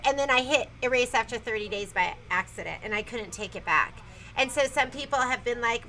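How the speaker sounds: ordinary talk, nothing marked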